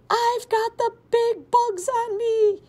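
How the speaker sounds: high, loud, slow panicked yell. A narrator's reading. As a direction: scream it in full panic. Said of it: "he yelled".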